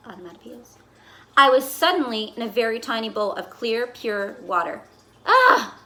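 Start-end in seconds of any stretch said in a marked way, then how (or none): 5.3-5.8 high, loud ah of relief; blissful sigh. Acted. Fish sighing happily in his new private bowl. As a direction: let out a delighted ah.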